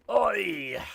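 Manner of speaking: sharp shouted call; loud call. Real, not acted. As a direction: call out loudly to the room. Calling out to get attention.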